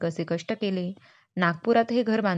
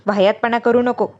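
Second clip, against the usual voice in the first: snapping mother; 8 dB louder than usual. Retold or imitated. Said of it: "तशी आई वसकन ओढून म्हणाली".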